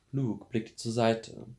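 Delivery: usual speaking voice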